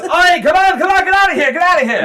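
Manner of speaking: high, loud goblin dad yelling; hammed-up exasperated holler; shooing shout. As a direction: chase the kids out of the shop. Stepdad shooing kids out of workshop.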